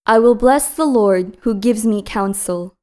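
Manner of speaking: usual speaking voice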